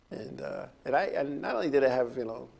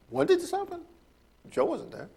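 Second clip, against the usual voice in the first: lightly voiced witness, pitched much higher; incredulous witness. Long Island witness defending Joe to the official, high and incredulous. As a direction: play a witness baffled by the charge.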